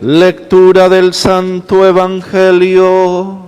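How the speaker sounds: ordinary talk, nothing marked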